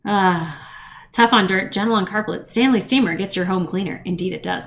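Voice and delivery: ordinary talk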